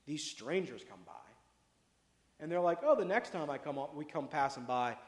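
ordinary delivery

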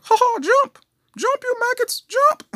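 very high, loud barked orders; mock drill-sergeant shout. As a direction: yell at recruits in a hammed-up voice.

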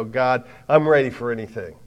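fired-up soldier ready for anything; loud gung-ho soldier. Retold or imitated. Men who shout hoorah.

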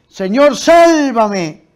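loud, high, stretched-out acted plea; retold shout for help. Peter to Jesus as he sinks, retold shout for help. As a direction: shout it slowly, pleading for rescue. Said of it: "Pedro grita".

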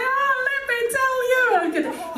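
high, eager oh let me tell you; high keen unloading; eager overshare. Retold or imitated. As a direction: pounce on the question delighted. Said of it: "I was like".

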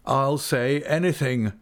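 ruined man past caring; desperate. A narrator's reading. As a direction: blurt it out desperately. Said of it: "with a desperate air".

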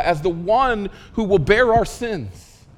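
ordinary talk

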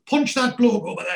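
high, mock urgent command; drunken impulse. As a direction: blurt it like a sudden daft urge. Drunken head voice giving orders.

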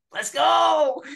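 high, loud whooping "Let's go!"; cheering shout. Retold or imitated. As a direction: whoop it out like a fired-up fan. Cheering the commander's backing.

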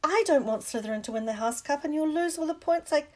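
high, loud, indignant telling-off; angry scolding. A narrator's reading. Hermione following Ron through the portrait hole, scolding angrily. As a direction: scold them like an angry goose. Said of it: "hissing at them like an angry goose".

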